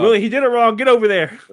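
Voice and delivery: high, loud barked order; bossy shout. Acted. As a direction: order him off, loudly. Bossing a guard in skit.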